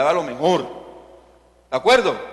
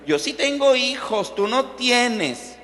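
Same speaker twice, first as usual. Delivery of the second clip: higher-pitched gloating taunt, slowing on the last words; mocking rival; 6 semitones higher, slower than usual. Penina taunting childless Ana, smug and mocking. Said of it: "Imagínense Penina, cada vez que la veía".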